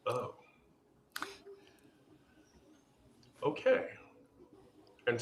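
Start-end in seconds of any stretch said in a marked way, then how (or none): 0.1-3.8 halting, with a very long pause; stunned. Retold or imitated. Learning who the old coworker is, stunned and halting. Retold stunned reaction to revelation.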